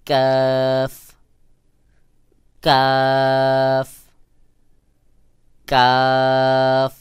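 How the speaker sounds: কাফ light with air flowing out, drawn out loudly; Arabic কাফ, unrounded vowel, long and loud; thin, natural কাফ. Shown as a demonstration. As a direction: hold a thin, open কাফ. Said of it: "কাফকে পাতলা করে উচ্চারণ".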